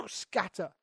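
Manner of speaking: ordinary delivery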